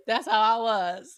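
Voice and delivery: high voice cracking with laughter; crying laughing through the words. Real, not acted. Cracking up over the [name] meme, laughing hard. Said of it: "laugh cry".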